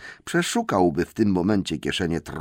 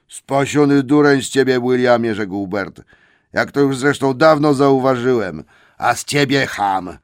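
loud, higher troll abuse; troll insult; 4 semitones higher, 6 dB louder than usual. Acted. Trolls insult each other, loud and higher. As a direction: sneer it out.

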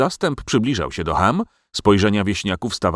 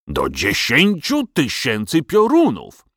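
slow, high-pitched angry oath; outraged king, dragging out each word; boiling anger; 5 semitones higher, slower than usual. A narrator's reading. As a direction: seethe out a drawn-out royal curse. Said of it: "Król znów zakipiał z gniewu".

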